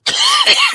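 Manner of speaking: sharp real cough; a loud dry cough. Real, not acted. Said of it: "Excuse me".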